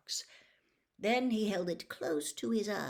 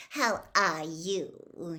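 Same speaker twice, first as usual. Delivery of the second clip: slow, drawn-out fawning greeting; gushing reporter; 4 dB louder, much slower than usual. Acted. Rita fawning over Dumbledore, slow gushing greeting. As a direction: lay the charm on thick.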